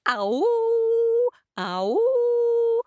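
high loud howling ow; dog howl. A narrator's reading. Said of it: "The dog howled".

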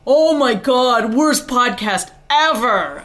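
slow, loud mocking whine; mock listener complaint. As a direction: imitate a disgusted listener. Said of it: "from the last podcast that".

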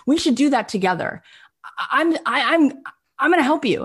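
high, stammering enthusiasm; acted-out excited pledge; eager offer. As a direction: stumble over your words offering help. Acting out Brian's eager pitch.

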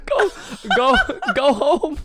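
slow, high and loud, still laughing; laughing through words. Pretend. Sends bull home, laughing.

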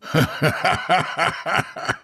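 high, breathy mocking laugh. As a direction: laugh at him openly. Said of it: "James's laugh filled the empty lobby".

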